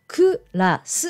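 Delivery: クラス in separate Japanese syllables, ku, ra, su; katakana English. Shown as a demonstration. Teacher showing what won't get through, syllable-by-syllable katakana クラス. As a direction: spell out クラス with every vowel sounded. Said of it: "って言っても通じません".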